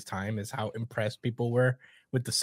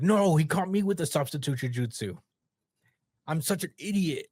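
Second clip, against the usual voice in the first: mock frustration; 6 semitones higher than usual. Sasuke voiced, caught out.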